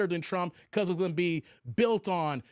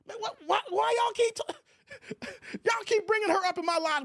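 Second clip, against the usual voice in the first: high-pitched, laughing protest; mock outrage at the chat; exasperated disbelief; 13 semitones higher than usual. Real, not acted. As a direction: wail it out, baffled and half laughing. Viewers keep mentioning Kaya.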